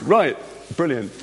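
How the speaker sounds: normal talking voice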